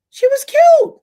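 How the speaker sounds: squealed very high and loud; gushing. Real, not acted. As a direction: squeal with delight. Gushing about the clerk.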